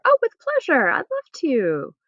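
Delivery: very high, gushing "Oh", then slow; delighted yes. Shown as a demonstration. Teacher acts a delighted acceptance, very high. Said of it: "means pleasure".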